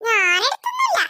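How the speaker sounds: high put-on small boy voice; little boy voice. Little brother feigning innocence to mother. Child character voice.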